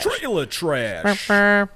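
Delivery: very slow, high and loud catchphrase; drawn-out announcer call. Shown as a demonstration. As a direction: stretch it out like a hype call. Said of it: "What do we say first?".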